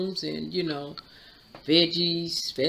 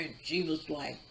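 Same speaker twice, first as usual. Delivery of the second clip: normal talking voice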